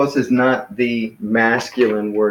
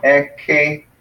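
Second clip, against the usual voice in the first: "eke" slowly as e-ke, higher pitch; syllable by syllable; 4 semitones higher than usual. Shown as a demonstration. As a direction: sound out "eke" in two separate beats. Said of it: "this is a vowel".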